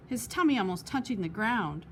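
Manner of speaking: ordinary delivery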